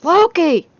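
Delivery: a high, loud shout of his name; shocked yell. Acted. Woman calling Loki after seeing giant rabbits, shouting. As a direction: yell for him from across the house.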